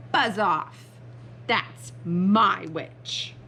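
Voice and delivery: slow, menacing monster; terrible beast voice. Acted. Beast claiming the witch from dragon, slow monster voice. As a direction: warn the dragon off word by word. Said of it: "its terrible voice".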